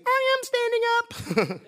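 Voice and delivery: tiny, very high squeaky voice; slow, high squeal of a small boy protesting; little kid voice. Retold or imitated. Preacher imitating a small junior high kid answering back, little voice. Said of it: "I heard this little voice go".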